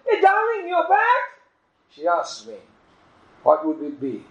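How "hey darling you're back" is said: very high, sing-song "Hey, darling"; a wife's sugary surprise, overplayed; falsely sweet wife. Wife greets husband falsely.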